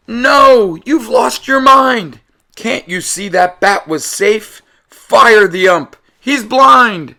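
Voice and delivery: high, loud outraged yelling; angry crowd. Acted. The crowd yelling at the umpire, outraged. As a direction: boo the umpire like furious fans.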